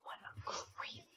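slow whispered aside; teen whispering about her creepy teacher; disgusted whisper. Acted. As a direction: whisper your disgust under your breath.

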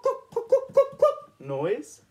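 high clucking hen sounds; high, slowing chicken clucks. Retold or imitated. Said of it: "What sort of animal makes a cluck".

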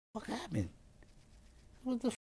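high, soft dazed disbelief; dazed bewilderment. Retold or imitated. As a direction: come to confused, pitched high and soft. Reliving waking up dizzy.

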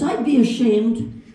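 put-on slow Irish-accented punchline. Paddy answering his friends, slow Irish accent. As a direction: land it slowly in an Irish accent. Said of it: "Paddy says".

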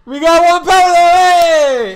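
slow, high, loud cry; excited shout. Acted. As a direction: proclaim it with glee. Character announces penalty.

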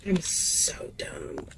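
low, slow whisper of defeat; whispered self-scold. Real, not acted. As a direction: breathe it out slowly, beaten. Self-scolding after failing.

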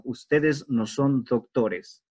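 each word spaced out for the class; slow correct form. Shown as a demonstration. Teacher slowly giving the class the correct form. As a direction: lay the right version down slowly. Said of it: "No podemos decir".

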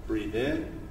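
soft, low meditation voice; guided breathing. Real, not acted. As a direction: guide them quietly through each breath, with long pauses. Leads breathing exercise.

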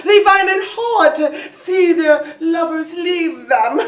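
normal talking voice

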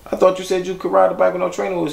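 high-pitched, rushed retold protest; puzzled father. Father questioning son about training wheels, puzzled and high.

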